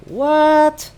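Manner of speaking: high, loud mock disbelief; hammed-up, high-pitched shock; incredulous outburst. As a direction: shoot the pitch way up in retold outrage. Retold outraged disbelief at tournament order.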